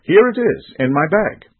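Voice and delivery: high, loud burst of discovery; excited cry. A narrator's reading. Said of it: "he cried out to his brothers".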